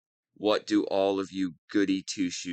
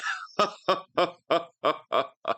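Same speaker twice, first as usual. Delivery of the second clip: slow, gloating villain cackle; evil cackle; 7 semitones higher than usual. Acted. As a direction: cackle over the caged hero.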